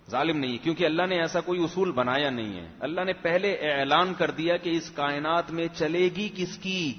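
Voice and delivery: usual speaking voice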